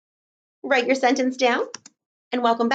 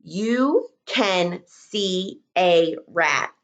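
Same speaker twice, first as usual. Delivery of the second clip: slow dictation; 2 semitones lower, much slower than usual. Shown as a demonstration. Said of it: "Let's see".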